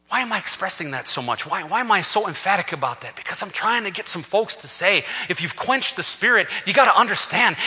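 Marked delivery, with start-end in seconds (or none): none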